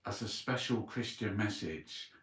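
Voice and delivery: ordinary delivery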